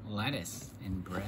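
low, slow pet character voice; deep toy voice. Pretend. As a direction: drop the voice way down for the toy.

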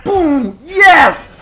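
high, loud celebration; triumphant yell. Real, not acted. Gamer landing his one chance, high triumphant yell. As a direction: yell as the hit lands.

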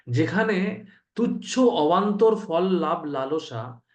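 deliberate scripture-reading pace; slow emphatic reading. Shown as a demonstration. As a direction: read the text out slowly and weightily. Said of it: "এটা একটা অত্যন্ত গুরুত্বপূর্ণ বিষয়".

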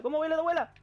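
high, loud taunting yell; mocking yell. Real, not acted. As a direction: jeer at them at full voice. Taunting enemies in the game.

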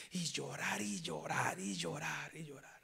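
slow whispered lament; whispered, slow repetition. Real, not acted. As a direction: let each llorar fade in a whisper.

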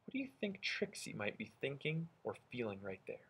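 normal talking voice

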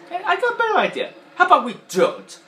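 high, loud sarcastic cartoon voice; Mister Potato Head impression. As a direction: shoot the idea down loudly. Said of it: "Potato Head went like this".

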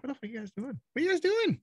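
high, rising disbelief at his own people; exasperated outcry. Retold or imitated. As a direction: pitch up in mock outrage at fellow Texans. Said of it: "I'm still like".

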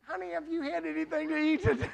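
high, quick fussing coo; doting wife voice. Retold or imitated. Wife fussing over husband at Golgotha, high doting coo. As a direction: fuss over him sweetly. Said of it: "wife interrupting the tour".

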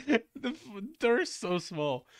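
high, loud giggling through the words; real giggle fit at the tiny door; laughing. Real, not acted.

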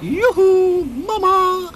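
high, loud sing-song call to Mama. Acted. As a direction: call down from the sky, long and high. Children calling from the clouds.

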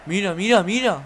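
loud high eager calls; excited shout. Real, not acted. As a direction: urge it on at the screen. Watching the attack.

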